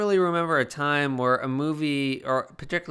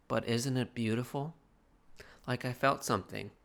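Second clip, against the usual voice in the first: wistful; 8 dB quieter than usual. Real, not acted. Wistful after loud curse.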